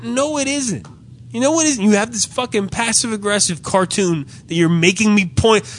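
high-pitched, exasperated retort; indignant protest. Retold or imitated. Customer protesting the passive-aggressive cartoon, high and indignant. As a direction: act out arguing back at the clerk. Acted argument with the clerk.

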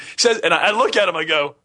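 ordinary talk

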